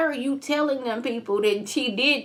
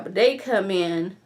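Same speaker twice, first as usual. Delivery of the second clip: usual speaking voice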